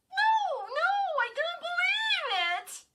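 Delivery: very high, loud shriek of disbelief. A narrator's reading. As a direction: shriek in horror. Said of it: "Lindy shrieked".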